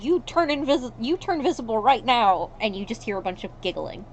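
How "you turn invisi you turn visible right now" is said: louder, higher bossy demand; scolding command. Acted. Group pointing at nothing, loud exasperated command. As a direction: order the invisible ones to show themselves, loudly. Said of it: "pointing at nothing and going".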